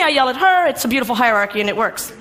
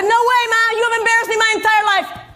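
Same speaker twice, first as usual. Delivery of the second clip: defiant retort; 9 semitones higher than usual. Retold or imitated. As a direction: throw it back at her, defiant. Standing up to her mother.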